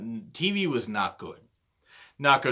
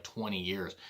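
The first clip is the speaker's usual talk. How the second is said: ordinary talk, nothing marked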